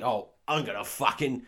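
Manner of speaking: loud, slow Cockney threat; loud mock Cockney menace; Cockney thug. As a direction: growl the threat like an East End heavy. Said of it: "one of them says".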